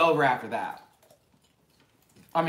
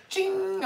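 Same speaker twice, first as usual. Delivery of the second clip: high squeal. Retold or imitated. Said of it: "when she's like".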